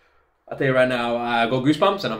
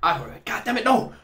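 ordinary talk

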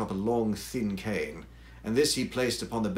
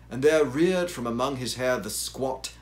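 ordinary delivery

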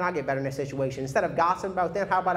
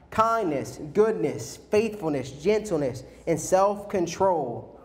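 slow emphasis; much slower than usual. Acted. Ends fruit list, drawn out.

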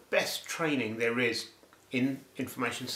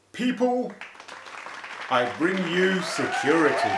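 loud, higher ranting orator voice; reenacted rant at a forum, higher and louder; mock stage rant; 3 semitones higher, 4 dB louder than usual. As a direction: boom it out like a grand stage speaker. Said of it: "as a reenactment".

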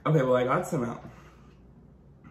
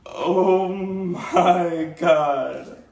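amazed; 4 semitones higher, 6 dB louder, much slower than usual. Real, not acted. Giant lobster starts moving.

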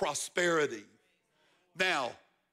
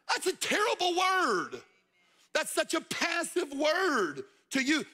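high, slow, stretched-out protest; emphatic objection; 9 semitones higher, slower than usual. Retold or imitated. Preacher retelling a friend's objection to a word, emphatic. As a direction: drag out the complaint with raised pitch.

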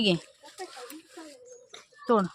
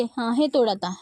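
ordinary talk, nothing marked

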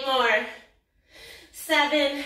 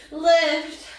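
ordinary talk, nothing marked

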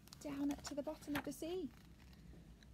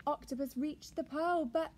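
plain reading voice

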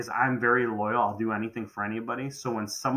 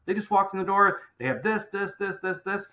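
high, rushed, awestruck gushing; excited gushing; 8 semitones higher than usual. Retold or imitated. Staff gushing over a newcomer at the door, high excited gush. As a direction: gush like you've just seen a star walk in. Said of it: "they're like".

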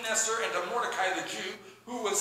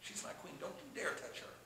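protective hush; 3 semitones lower, 8 dB quieter than usual. Retold or imitated. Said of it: "he goes".

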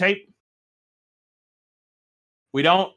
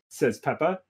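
usual speaking voice

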